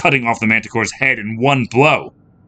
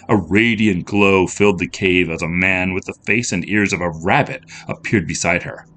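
ordinary delivery

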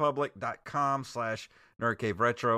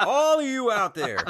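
laughing; 10 semitones higher, 6 dB louder than usual. Pretend. Laughing through mock scolding.

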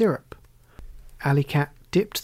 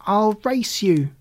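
shout; 5 semitones higher, 3 dB louder, slower than usual. A narrator's reading.

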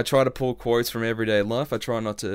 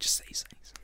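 bored whisper; 10 dB quieter than usual. Pretend. Whispered checkout line.

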